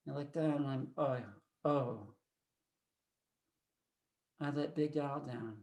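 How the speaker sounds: low, hushed, defeated storytelling; very soft and downcast; crestfallen. Acted. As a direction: let the voice sink in dazed disappointment. Dazed, crestfallen after failing the team.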